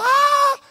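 high, sudden mock shriek of a frightened woman; mock scream. Preacher mocking women screaming at the empty tomb, high mock shriek. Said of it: "nos vamos a estar gritando".

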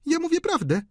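very high, cool woman's voice; cool high-voiced accusation; cold baroness. A narrator's reading. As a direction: state it coolly, pitched high. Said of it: "powiedziała chłodno baronowa".

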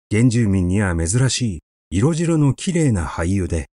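plain reading voice

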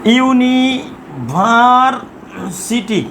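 University very slowly, broken into ইউনি, ভার, city; slow syllable-by-syllable. Shown as a demonstration. Teacher dictating university as an example, very slow and broken up. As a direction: draw out each part of university.

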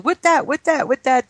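put-on high, babbling little-kid voice; toddler talk. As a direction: pester daddy like a tiny child. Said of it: "started talking different with the father".

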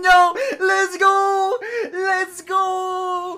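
drawn-out victory yell; celebratory shouting. Real, not acted. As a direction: cheer it, sing-song at the end. Celebrating shiny.

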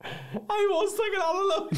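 high-pitched laugh through the words, slow. Real, not acted. Caught out on his mistake, he laughs it out.

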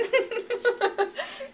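high laugh. Real, not acted. Laughs after struggle.

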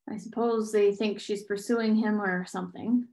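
ordinary delivery

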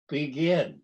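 ordinary delivery, nothing marked

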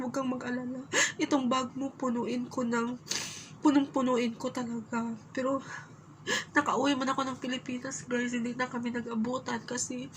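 fighting back tears while remembering her late auntie; tearful. Real, not acted. Telling viewers about her late aunt, choked up and teary.

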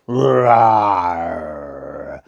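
low drawn-out roar; lion roar. Acted. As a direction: let out a long lion roar. Lion roaring through forest.

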